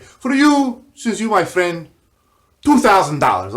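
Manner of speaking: high, loud mock Russian haggle; lightly put-on Russian accent. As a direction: play the sly Russian dealer naming his price. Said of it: "he talks like Tranquilo Genie".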